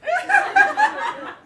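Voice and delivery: a squeaky high laugh; high giggle. Retold or imitated. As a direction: let out a tiny shocked giggle. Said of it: "I actually went like this".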